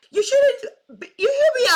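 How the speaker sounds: breaks into a laugh; incredulous laugh. Real, not acted. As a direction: laugh it out mid-sentence. Laughing mid rant.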